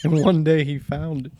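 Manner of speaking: ordinary talk, nothing marked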